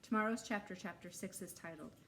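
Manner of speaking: usual speaking voice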